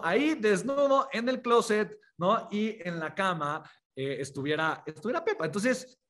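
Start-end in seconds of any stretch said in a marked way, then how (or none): none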